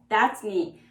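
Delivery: ordinary talk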